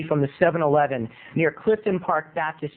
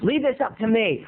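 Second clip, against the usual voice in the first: ordinary talk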